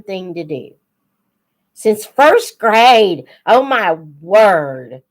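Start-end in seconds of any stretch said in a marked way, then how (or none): none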